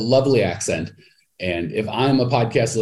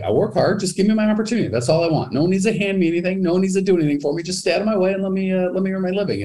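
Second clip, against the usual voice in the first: much higher, rapid-fire imitation of a self-reliant worker; voicing determined immigrants; 10 semitones higher, faster than usual. Coach voicing immigrants who want only an opportunity, determined and insistent. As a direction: imitate someone insisting, high-pitched and rushing on.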